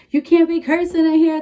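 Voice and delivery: imitating the nurse. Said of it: "the nurse is like".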